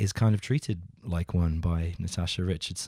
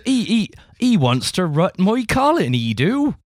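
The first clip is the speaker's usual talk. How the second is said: much higher, louder hammed-up West Country voice; Bristol accent; 11 semitones higher, 8 dB louder than usual. Teasing a co-host in a hammed-up Bristol accent. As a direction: ham up a Bristol yokel. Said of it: "Probably from Clifton".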